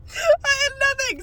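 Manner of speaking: high squeal of laughter, stretched slowly; laugh-crying at being broke. Real, not acted. Telling viewers she was broke, laugh-crying. Laughing about having no money.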